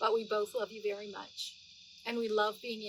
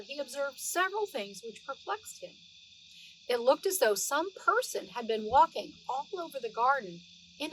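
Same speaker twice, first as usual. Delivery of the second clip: lively read-aloud storytelling voice; story narration; 3 semitones higher, 4 dB louder than usual. Acted. Grandma reading Beatrix Potter to the children, animated storytelling voice. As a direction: read it like a bedtime story for little ones.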